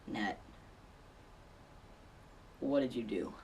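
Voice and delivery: low, hushed, long pause after the name; soft, low and deliberate reproach; grave confrontation. Retold or imitated. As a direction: imitate a friend's quiet, ominous question. Friend arrives, grave confrontation retold.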